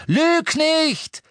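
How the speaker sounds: very high, loud snap; hurled. A narrator's reading. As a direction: fling it at her. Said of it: "schleuderte ihr Ron entgegen".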